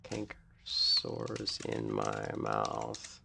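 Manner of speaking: slowly spelled out; slow dictation. Shown as a demonstration. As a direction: drag it out for dictation. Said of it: "I don't even know how to spell it".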